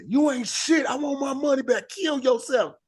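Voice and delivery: high-pitched mock heckle; high, hammed-up heckler yell; screaming heckler. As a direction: shout it like an irate audience member. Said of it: "somebody gonna scream".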